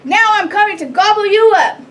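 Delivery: loud, high troll threat; hungry troll bellowing; roaring troll. A narrator's reading. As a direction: roar the threat at full voice. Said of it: "roared the troll".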